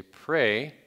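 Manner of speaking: loud, held-out single word; emphatic command. Shown as a demonstration. Preacher prompts listeners with the command, emphatic call. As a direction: call it out as an order. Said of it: "what would you say?".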